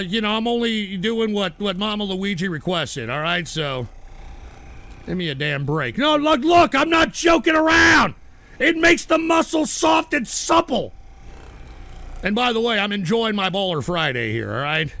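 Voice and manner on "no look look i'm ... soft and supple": higher, louder, insisting it's not a joke. Real, not acted. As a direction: raise your voice to defend yourself. Insists loudly it's a massager.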